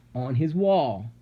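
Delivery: ordinary delivery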